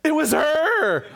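sudden high jump-scare shout; startling boo. Acted. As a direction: jump out at them, high and loud. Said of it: "Did I scare you?".

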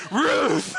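playful woof shaped into a word; talking dog. Acted. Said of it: "says the dog".

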